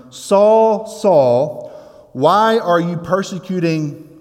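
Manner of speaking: loud, high calling out of Saul's name, then a pleading why; voice from heaven calling. Retold or imitated. Preacher voicing Jesus calling Saul from heaven, loud and ringing. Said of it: "he heard the voice saying to him".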